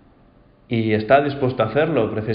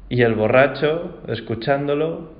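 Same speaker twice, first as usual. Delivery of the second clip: usual speaking voice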